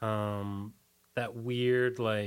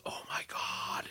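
hushed, slow gasp of disbelief; awed whisper; 7 dB quieter than usual. Retold or imitated. Young self whispering in shock at a conspiracy theory. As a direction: breathe it out like a stunned kid.

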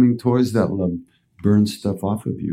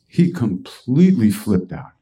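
usual speaking voice

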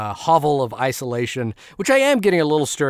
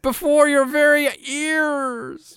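put-on high, slow creaky old voice; old person voice; 14 semitones higher, 3 dB louder, slower than usual. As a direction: play a doddering old man. Joking about aging, brain fog.

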